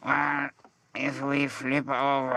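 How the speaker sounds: a hint of a nervous co-star, drawn out; imitating Kieran. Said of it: "And he goes".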